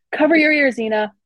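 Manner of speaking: loud high warning shout. A narrator's reading. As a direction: yell the warning over battle. Calling over the fray.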